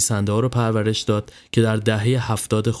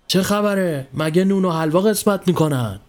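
high grumbling policeman voice; 7 semitones higher than usual. A narrator's reading. Policeman grumbling at the gawking crowd, high-pitched. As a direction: grumble at the crowd like a bossy cop. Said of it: "سپس غر زد".

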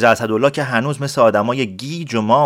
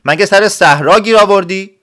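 louder, higher clerk snapping back; irritated scolding; 7 semitones higher, 5 dB louder than usual. Acted. Clerk scolds the noisy villager to hush, louder and irritated. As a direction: shoo him off with raised annoyance. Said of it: "صداش در اومد و گفت".

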